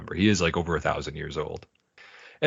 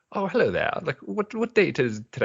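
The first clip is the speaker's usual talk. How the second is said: put-on higher, plummy old English accent; 9 semitones higher than usual. Bender switches to a posh old English accent, much higher. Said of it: "and his accent is".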